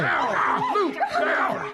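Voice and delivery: high panicked shout at the dog; frantic yell. Acted. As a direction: call the dog off in alarm. Dog bites stranger, owner yells.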